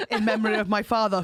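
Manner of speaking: ordinary talk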